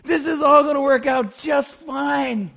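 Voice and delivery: loud, slow shout of reassurance; yell. Retold or imitated. Pastor yelling at the anxious couple to relax. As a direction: bellow it slowly to calm them. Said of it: "I just want to yell at them".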